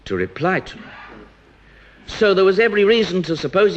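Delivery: usual speaking voice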